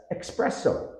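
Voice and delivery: espresso as ek-SPRESS-o; mispronounced with X. Shown as a demonstration. Teacher imitating a frequent mistake, X sound added. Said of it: "frequently mispronounced as".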